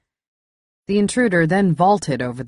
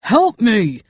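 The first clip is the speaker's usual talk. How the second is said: strained, pained plea for help; pained groan; 7 semitones higher, 7 dB louder than usual. Acted. Injured intruder begs for help, strained pained cry. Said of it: "he groaned".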